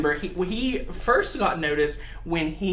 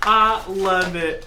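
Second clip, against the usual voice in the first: normal talking voice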